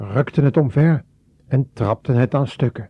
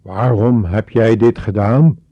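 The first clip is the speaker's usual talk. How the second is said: louder, accusing demand; trembling with rage; 8 dB louder than usual. A narrator's reading. Mozes confronting Aaron, furious and loud. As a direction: confront him in anger. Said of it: "Bevend van toorn".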